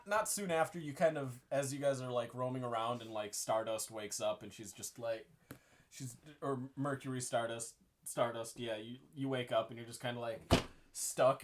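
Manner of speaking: ordinary talk, nothing marked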